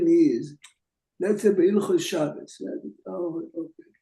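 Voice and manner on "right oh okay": normal talking voice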